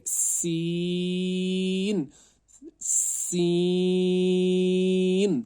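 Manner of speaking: teacher chanting a long سین; stretched recitation. Shown as a demonstration. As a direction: draw out سین like Quran recitation. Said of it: "اس کو کھینچنا ہے".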